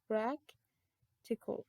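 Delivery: practical stretched very slowly into two parts; slow syllable demo. Shown as a demonstration. Teacher stretches practical to show two syllables. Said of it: "this is like two syllables".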